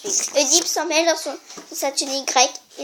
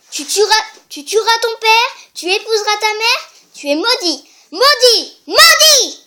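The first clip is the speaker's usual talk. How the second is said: building to a loud high cry on maudit; oracle's curse; 7 semitones higher, 7 dB louder than usual. Acted. The oracle cursing Oedipus, rising to a shout. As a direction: curse him louder and louder.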